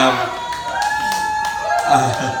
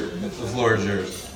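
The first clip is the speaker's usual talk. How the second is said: normal talking voice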